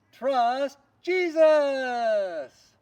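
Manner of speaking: loud, high rallying cry; shouted slogan. Real, not acted. Teacher rallying the class with a big shout.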